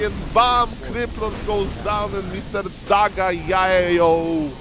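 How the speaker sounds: loud, slow, drawn-out victory call; excited call. Acted. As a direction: call the winner out. Caster calls the kill.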